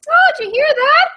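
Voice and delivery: high, loud oblivious mother; hammed-up high mom voice; clueless mom. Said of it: "kind of mom that would be like".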